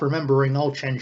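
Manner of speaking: plain reading voice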